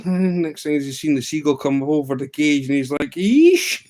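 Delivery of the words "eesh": high, loud drawn-out yelp; hammed-up screech of dismay; sudden piercing mock yelp; imitated cry of alarm. Imitates reaction to seagull.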